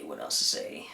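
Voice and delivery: low whispered repeat. Real, not acted. Repeats the question playfully.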